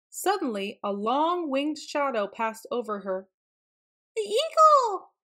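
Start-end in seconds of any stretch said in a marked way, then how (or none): 4.1-5.1 high, loud alarm cry; alarmed little-animal cry. A narrator's reading. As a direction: shriek a warning, pitched way up. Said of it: "she cried".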